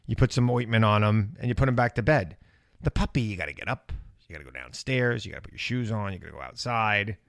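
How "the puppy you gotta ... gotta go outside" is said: quiet, worn-out voice with little left; lost voice. Real, not acted. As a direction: talk with a tired, spent voice. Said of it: "that's why I have no voice".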